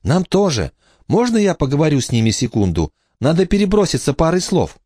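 put-on high child's voice; polite little girl. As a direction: excuse yourself in a high girlish voice. Emma asks for a moment.

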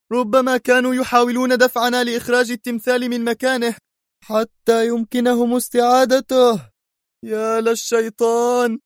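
convinced of the plot; mounting agitation. Acted. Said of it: "في انفعال متزايد".

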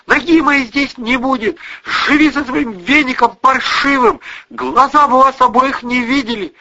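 loud, high-pitched tirade; angry shouting. A narrator's reading. Daughter storms at mother and her lover, shouting.

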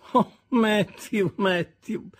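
slow, high sigh over the name; mock lament. Sarah Pocket mourns Matthew's foolishness in a slow, mock-sad sigh. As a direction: lament him with a shake of the head. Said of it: "scrollando tristemente la testa".

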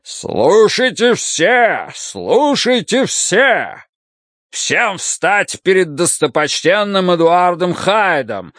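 high, slow ringing proclamation; town crier. A narrator's reading. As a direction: proclaim it slowly to the hall. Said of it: "и начал снова".

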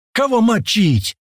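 slow, high-pitched booming question. A narrator's reading. Ivan with a machine gun, booming. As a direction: draw it out as a big booming call. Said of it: "пробасил Иван с пулемётом".

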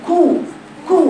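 كو with lips fully pursed; rounded damma. Shown as a demonstration. Teacher demonstrates a complete damma. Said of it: "أتمي ضم شفتيك".

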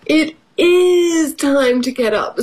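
high, insistent dog character; storybook Dog. A narrator's reading. Storyteller as Dog insisting to Pig, high and emphatic. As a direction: lean hard on IS, pitched high. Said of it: "said Dog".

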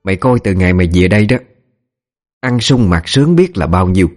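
mild acted tirade; shrieking scolding. A narrator's reading. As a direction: scold the servant, with understated force. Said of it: "Bà ta bắt đầu tru tréo". (giọng bà chủ chửi bới, hơi gắt)

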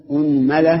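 أنملة as "unmala", u first, a on the meem; list-like demo of أنملة as unmala; u then a. Shown as a demonstration. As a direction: say أنملة as UN-ma-la, rising at the end. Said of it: "ضم الهمزة".

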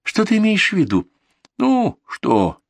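put-on high girlish voice, puzzled; Ktoya. A narrator's reading. Ктоя asks the donkey, high and puzzled.

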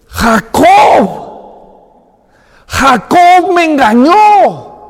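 high, loud, slow furious cry; angry outcry. Retold or imitated. Cheated brother crying out in rage, high and loud. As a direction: shout out the betrayal. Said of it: "Esaú se enoja y dice".

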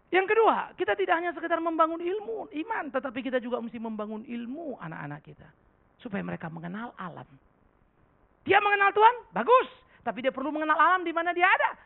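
high, loud pulpit voice; full-force sermon delivery; fiery preaching. Acted. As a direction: drive the point home to the congregation.

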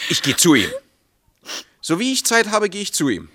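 loud, high-pitched giving in; agitated promise. Acted. Husband promising wife he'll see the mayor, agitated. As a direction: blurt the promise, voice pushed up. Husband gives in under pressure.

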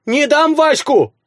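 high, slow desperate wail; wailing peasant woman. Acted. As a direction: wail to protect the daughter. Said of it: "запричитала баба".

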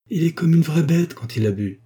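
voiced protest of a wronged wife; indignant complaint. A narrator's reading. Wife complains of drunken husband.

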